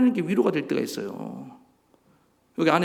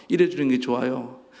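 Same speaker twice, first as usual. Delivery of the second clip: ordinary talk, nothing marked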